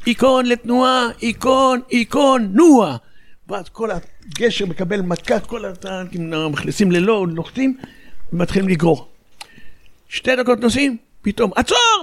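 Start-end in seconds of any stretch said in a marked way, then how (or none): 0.1-3.0 battalion commander on radio. Retold or imitated. Battalion commander orders the tanks to move, imitated radio call. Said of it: "המג"ד בקשר חוט". 11.5-12.0 sudden high-pitched cry to halt; imitated radio shout. Tank commander suddenly halts the convoy over the radio, mimicked shout. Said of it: "מישהו צועק בקשר".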